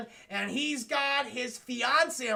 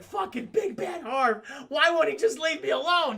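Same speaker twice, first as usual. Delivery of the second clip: frustrated outburst; 6 semitones higher, 6 dB louder than usual. Retold or imitated. Harvey despairs about his alter ego.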